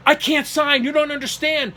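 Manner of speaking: high-pitched mock panic; imitated freak-out. Father refusing to sign for his son, imitated freak-out. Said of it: "He starts to freak out".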